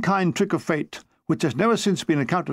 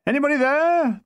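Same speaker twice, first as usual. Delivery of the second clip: shouting into darkness; 9 semitones higher than usual. A narrator's reading. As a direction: call out into an empty field, pitch raised. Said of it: "I shouted into the darkness".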